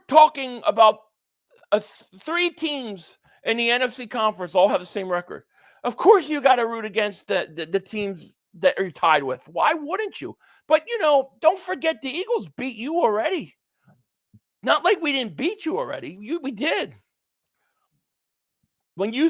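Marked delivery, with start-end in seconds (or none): none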